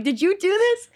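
high, loud mock outrage; joking accusation. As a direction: pretend to fire your friend, playfully indignant. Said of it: "I'm joking with him".

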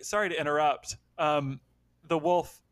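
normal talking voice

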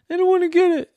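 high, loud whine; whiny refusal. Acted. Jenny refusing her brother, high and whiny. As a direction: refuse like a sulky kid.

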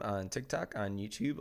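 hoarse, sick-sounding voice; talking with a cold. Real, not acted. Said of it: "I really like your sick voice".

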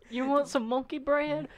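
put-on high, slow old lady voice; grandma voice. Grandma offering monkey bread, high and slow.

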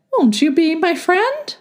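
high squeaky letter voice; a tiny paper letter asking sweetly; talking letter. A narrator's reading. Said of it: "And the letter said".

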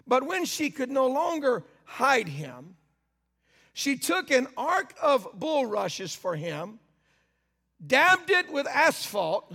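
slow, solemn reading of scripture. Acted. Preacher slowly reads the Bible to the congregation. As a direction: read the verses slowly, weighing each line.

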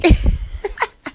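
loud, high burst of laughter; sudden loud cackling laugh. Real, not acted. Happy about the trip, laughing out loud. Cracks up at own remark.

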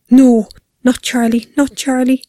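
drawn-out horrified denial; horrified gasp. A narrator's reading. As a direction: refuse to believe your child is taken. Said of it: "Julia gasped, fought to get air".

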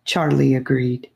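ordinary delivery, nothing marked

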